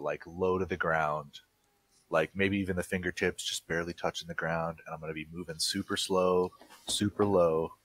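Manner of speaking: ordinary delivery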